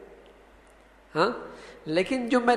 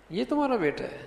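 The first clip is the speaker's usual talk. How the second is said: high-pitched surprise, falling away at the end; high, amazed query; astonished question; 7 semitones higher, 4 dB quieter, faster than usual. Shown as a demonstration. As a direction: ask it in disbelief, voice up high. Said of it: "حیرت کا اظہار ہے".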